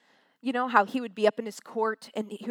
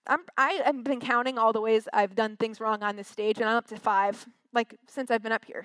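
normal talking voice

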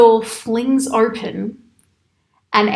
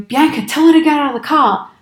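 mock dad yell; 4 semitones higher, 4 dB louder than usual. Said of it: "my dad starts yelling at me".

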